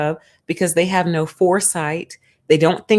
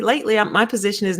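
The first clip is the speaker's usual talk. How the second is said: normal talking voice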